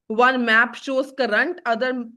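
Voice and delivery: very slow, each word set apart; slow dictation. Shown as a demonstration. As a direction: dictate it so they can write. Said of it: "teacher dictates an English definition". (बहुत धीमे लिखवाते हुए)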